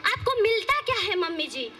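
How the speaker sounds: ordinary talk, nothing marked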